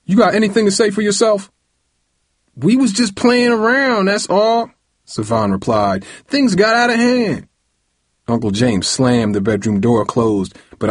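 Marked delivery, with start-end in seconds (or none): 0.1-1.5 high, fast, challenging question; heated, rapid-fire challenge; angry demand. A narrator's reading. Furious father demands an answer. 2.6-4.7 put-on very high boyish whine; high-pitched teenage self-defense; defensive protest. A narrator's reading. Son defends himself to furious father. 6.3-7.5 put-on very high, boyish excuse; defensive protest. A narrator's reading. Son defends himself to furious father.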